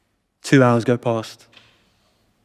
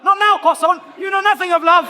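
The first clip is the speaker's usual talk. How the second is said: high, hammed-up shout; mock shout. Yelling at the inner voice in his head, hammed-up and high.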